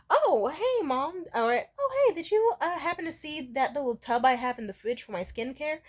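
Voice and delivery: light, high-pitched friendly query; acted casual asking. Voices a friendly question to mom.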